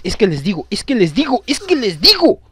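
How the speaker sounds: building from insistent to a high, loud protest; mounting exasperation. Real, not acted. Gamer protesting as the game goes wrong, mounting exasperation. Frustrated repeating complaint in game.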